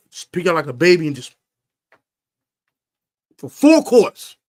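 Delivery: rising to a high loud yell; worked-up yell. Real, not acted. Fan ranting about Cowboys, building to a yell. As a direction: build into a shout.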